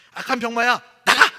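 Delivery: sudden loud command at the sickness; imitated preacher shout. Revival healer yelling the illness out, loud shout. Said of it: "이랬더니 진짜 나가는 걸".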